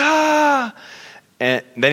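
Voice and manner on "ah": a sudden high piercing mock scream; loud high imitated yell. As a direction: shriek out loud like a stranger in the crowd. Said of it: "He's writhing in pain".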